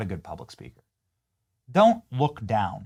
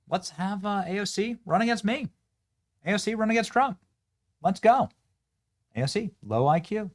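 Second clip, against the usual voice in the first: much higher, brash campaign-rally taunt; Trump; 11 semitones higher than usual. Retold or imitated. Vlogger quoting Trump mocking AOC, higher and brash. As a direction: toss off the jabs like a rally crowd-pleaser. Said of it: "President Trump has the best lines".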